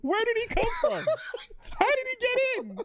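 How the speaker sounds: high, fast, laughing disbelief. Real, not acted. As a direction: squeal it through laughter.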